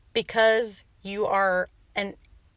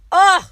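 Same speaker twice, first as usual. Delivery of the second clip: sharp loud high ugh of excitement; fired-up grunt; 11 semitones higher, 11 dB louder than usual. Real, not acted. Host hyping her listeners, a loud excited grunt. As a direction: let out a pumped-up ugh.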